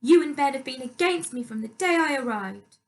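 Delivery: petulant stepmother. A narrator's reading. Louise's voice in the quarrel.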